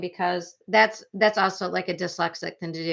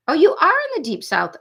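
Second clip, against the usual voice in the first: excited realization; 9 semitones higher, 7 dB louder than usual. Real, not acted. Reacting to chat location.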